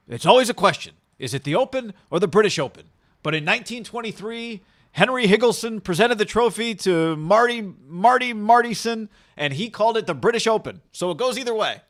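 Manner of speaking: hammed-up storyteller read; mock Mickelson tweet. Host retelling Mickelson's tweet in a mock pompous voice. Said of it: "Mickelson tweeted, like".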